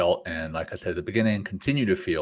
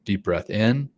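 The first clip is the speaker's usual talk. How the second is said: guided breathing. Acted. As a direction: lead the breaths gently. Leads breathing exercise.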